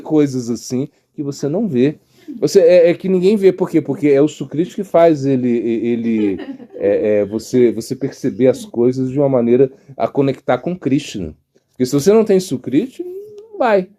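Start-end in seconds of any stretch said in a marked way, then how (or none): none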